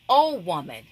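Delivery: loud OH falling into WOMAN; forceful, exclaimed OH; emphasis on OH. Shown as a demonstration. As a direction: lean hard on OH, then drop through WOMAN. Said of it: "That's emphasis".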